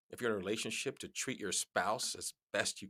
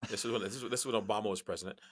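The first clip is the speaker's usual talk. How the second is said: normal talking voice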